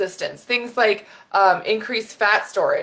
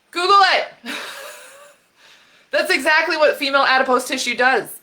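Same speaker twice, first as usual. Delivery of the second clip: fired-up shout, louder and higher; real yelling; 6 semitones higher, 3 dB louder than usual. Real, not acted. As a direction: blast it out, truly worked up. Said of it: "I'm yelling".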